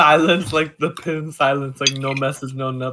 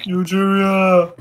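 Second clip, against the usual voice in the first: slow, stretched, louder call; drawn-out call; 4 semitones higher, 5 dB louder, much slower than usual. Pretend. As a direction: stretch the name out long. Calling out drawn-out name.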